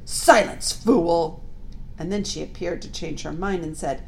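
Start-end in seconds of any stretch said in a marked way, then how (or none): 0.1-1.4 loud harsh witch snap; cold furious command. A narrator's reading. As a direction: bite it off like a furious queen. Witch snaps at Edmund.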